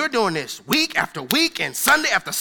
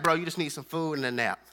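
gentle angel; 6 semitones lower than usual. Retold or imitated. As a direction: soothe the worn-out prophet. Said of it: "the angel came to him and was like".

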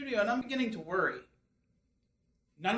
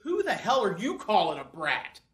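ordinary talk, nothing marked

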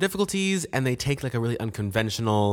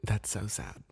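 hushed, whispered commiseration; mock sympathy; 9 dB quieter than usual. Mock sympathy over no Netflix.

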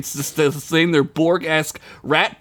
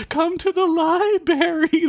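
high, slow, eerie spirit voice; haunting call from the dark; ghostly voice; slower than usual. Acted. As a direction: beckon him like a disembodied spirit. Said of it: "the voice said".

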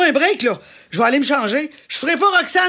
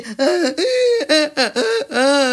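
string of wordless mock grunts. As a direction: grunt repeatedly to mimic a role without lines. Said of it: "chaque fois que tu grognes". (grognements imités, moqueurs)

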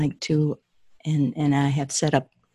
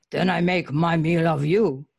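menacing ogre growl. Acted. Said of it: "the ogre growled".

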